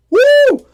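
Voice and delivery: a sudden high, loud whoop; mock cheer. A high, loud whoop for the girl getting away. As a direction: whoop it up like a fan at a game.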